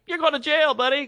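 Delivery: high mock tough-guy bust; lightly voiced undercover cop; sitcom cop. Friend retelling a sitcom bust, a high mock cop voice. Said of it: "they're like".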